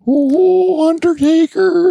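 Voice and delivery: very high, loud ghostly wail; Paul Bearer. Acted. Host showing off a wrestling manager, high wailing impression. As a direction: wail it out in a spooky falsetto. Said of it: "Paul Bearer?".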